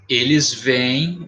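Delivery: eles veem drawn out slowly; slow teacher example; slow demo word. Shown as a demonstration. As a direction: stretch eles veem so the double e is heard. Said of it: "não leva mais acento".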